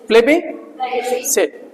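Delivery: slow, soft "प्लेबिसिट", pause before final "सिट"; split into parts. Shown as a demonstration. Teacher breaks the word down for students, "प्लेबि ... सिट" slowly. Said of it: "एक साथ में पढ़ा जाएगा".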